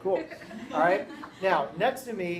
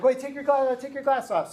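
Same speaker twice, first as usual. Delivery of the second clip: higher, louder imitation of a demand; excited students; 8 semitones higher, 5 dB louder than usual. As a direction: urge it, imitating an eager class. Students excitedly demand he remove glasses.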